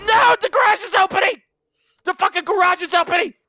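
high, loud alarmed yell; panicked shout. Real, not acted. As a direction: shout in dismay. Panic as garage opens.

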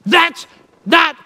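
slow, loud, forceful denial; aggressive. Retold or imitated. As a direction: bark the denial at him. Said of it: "very aggressively says".

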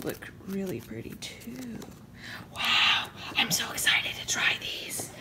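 excited unvoiced whisper; excited hushed whisper. Real, not acted. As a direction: whisper it giddily.

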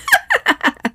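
a faint high cackle on the wind; icy laughter. A narrator's reading. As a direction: laugh like a cold winter witch. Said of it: "the faint sound of her icy laughter".

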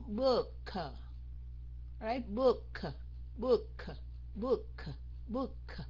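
"book" with a separate, audible puff on the K; exaggerated clear ending. Shown as a demonstration. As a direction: release the final K of "book" as "book-kuh". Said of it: "phát âm rõ ra trước".

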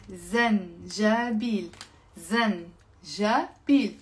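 زنجبيل drawn out syllable by syllable; slow and careful. Shown as a demonstration. Teacher slowly modelling the word for ginger to viewers. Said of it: "جينجر بالعربي يعني زنجبيل".

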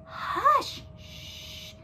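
high hush, then a whispered shh; hushing Santa. A narrator's reading. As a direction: shush someone urgently. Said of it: "said Santa".

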